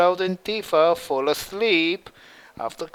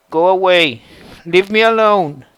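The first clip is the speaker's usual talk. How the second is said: louder, pushing her away; angry refusal; 7 dB louder than usual. A narrator's reading. Cloud shouting at Vicky through the door to leave. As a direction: tell her to leave, firmly and loudly.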